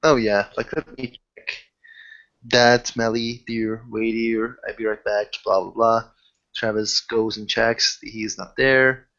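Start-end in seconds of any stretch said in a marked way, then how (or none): none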